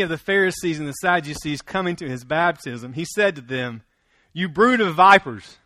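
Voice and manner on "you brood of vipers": loud, booming scripture rebuke; forceful rebuke. Retold or imitated. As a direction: read it as John's fierce public rebuke. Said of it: "We don't talk like that anymore".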